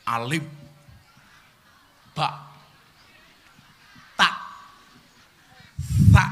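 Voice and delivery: Alif, ba, ta, sa each sounded separately with long gaps; articulated Arabic letters. Shown as a demonstration. Quran teacher showing beginners the letter sounds, articulated Arabic letters. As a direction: recite "Alif, ba, ta, sa" letter by letter, carefully. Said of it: "dimulai dengan membaca makhorijul huruf".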